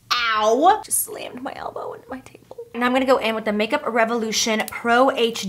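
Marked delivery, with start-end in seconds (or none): 0.1-0.8 pained yelp. Real, not acted. Slammed elbow into table. 0.8-2.6 hushed pained whisper; whispered aside. Real, not acted. Hurt elbow, whispered aside.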